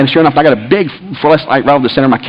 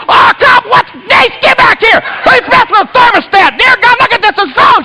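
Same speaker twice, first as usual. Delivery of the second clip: very high, alarmed outburst; frantic hollering. Retold or imitated. Dad calling Nate about the thermostat, frantic hollering. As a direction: imitate yelling for help in outrage. Acted hollering at frozen heat pump.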